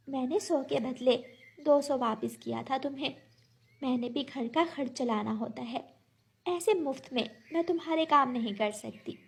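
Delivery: lightly voiced firm little bird. Bird refusing the crow new work until paid, quietly firm. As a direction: hold firm in a small, soft voice.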